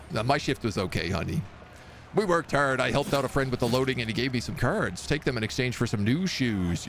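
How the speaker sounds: normal talking voice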